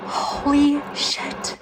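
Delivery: slow breathless whisper; whispered shock; stunned whisper. Real, not acted. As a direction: breathe it out in disbelief. Stunned by spirit box answer.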